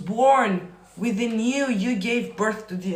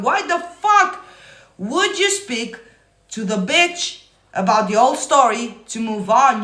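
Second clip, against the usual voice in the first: outraged yell; 2 semitones higher, 6 dB louder than usual. Real, not acted. Furious reply to viewer's comment.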